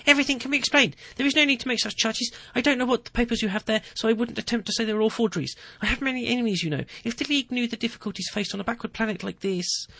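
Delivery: the caught official talks his way out; pleading president. Acted. Ferrero pleads his innocence.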